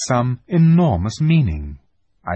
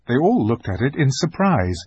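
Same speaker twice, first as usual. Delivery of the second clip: usual speaking voice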